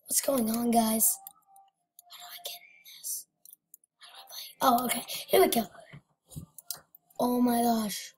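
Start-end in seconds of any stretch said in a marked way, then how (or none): 2.0-4.5 breathless high whisper. Real, not acted. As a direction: whisper it, puzzled. Confused aside to self.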